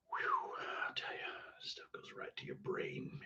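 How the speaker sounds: voiceless, very soft whisper. Real, not acted. Streamer aside about what hits the brain, whispered.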